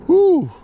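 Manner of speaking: high loud woo; excited whoop. Real, not acted. Celebrating a catch on camera with a loud high woo. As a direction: let out a joyful yell.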